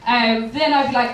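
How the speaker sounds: ordinary delivery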